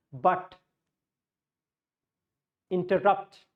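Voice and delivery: imitated Tamil accent with hard retroflex t. Teacher showing how a Tamil guy speaks, Tamil accent. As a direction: lightly put on a Tamil Nadu accent. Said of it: "That's how a Tamil guy speak".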